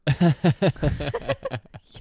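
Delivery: loud burst of laughter. Real, not acted. Laughing at own joke.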